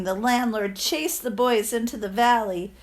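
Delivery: usual speaking voice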